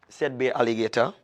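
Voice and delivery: lively storyteller reading a folk tale; storyteller voice reading to children; story narration. A narrator's reading. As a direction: tell it like a children's story, expressive and clear. Reads the folk tale aloud.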